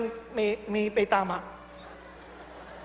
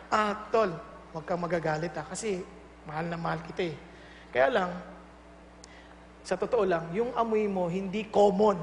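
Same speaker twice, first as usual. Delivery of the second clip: much lower, softer, tender voice; lovingly frank; 9 semitones lower, 7 dB quieter than usual. Shown as a demonstration. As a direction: gently tell a brother a hard truth. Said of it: "Sabihin mo lang".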